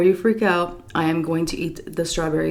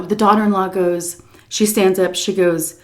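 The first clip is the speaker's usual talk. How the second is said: usual speaking voice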